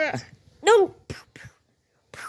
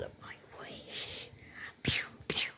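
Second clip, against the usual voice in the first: whispering; 11 dB quieter than usual. Pretend. Sneaking attack in play.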